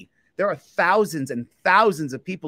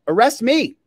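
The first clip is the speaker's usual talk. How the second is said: higher, louder taunt; dare; 7 semitones higher, 7 dB louder than usual. Real, not acted. Auditor loudly daring the police to arrest him. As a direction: throw the challenge at them.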